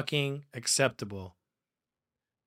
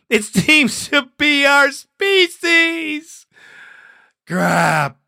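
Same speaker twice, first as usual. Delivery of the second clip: loud, higher put-on rant voice; hammed-up tirade of fake outrage; ranting caller; 4 semitones higher, 8 dB louder, slower than usual.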